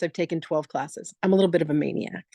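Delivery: ordinary talk, nothing marked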